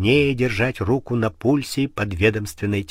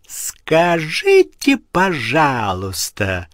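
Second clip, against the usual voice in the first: slow, higher, louder shriek; 4 semitones higher, 4 dB louder, much slower than usual. Acted. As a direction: screech it down the corridor. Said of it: "завизжала на весь коридор".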